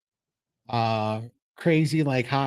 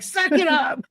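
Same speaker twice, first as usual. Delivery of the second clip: tough-love scolding. Retold or imitated. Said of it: "I'm like".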